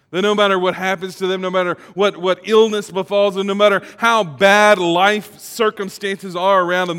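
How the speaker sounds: rising pulpit fervor; preaching. Acted. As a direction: build the preaching to a peak. Building sermon point.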